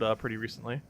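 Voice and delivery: ordinary talk, nothing marked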